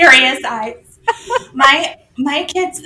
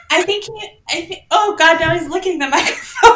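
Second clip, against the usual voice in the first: high, helpless laughter through the words; laughing dismay; 7 semitones higher than usual. Real, not acted. Owner reacts to dog licking the microphone, laughing. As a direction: exclaim while cracking up.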